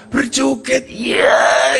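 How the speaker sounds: high joyful shout; joyful cry. Acted. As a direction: cry out in delight. Villager dancing for joy.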